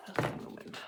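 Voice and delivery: whispered "Melting Moment", breathy with no voice; softly whispered aside. Real, not acted. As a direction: whisper the name to yourself. Whispering thread names to camera.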